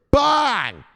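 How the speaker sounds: high, loud, drawn-out yell; showman's big shout; explosive catchphrase. Pretend. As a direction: fire it off at full blast.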